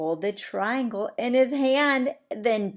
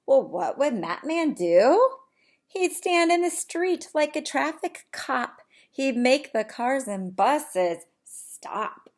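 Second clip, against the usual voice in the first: bright, lively storybook reading; animated read-aloud; 6 semitones higher, 6 dB louder than usual. Acted. Teacher reading to her class, lively storybook voice. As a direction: read it big for the little ones.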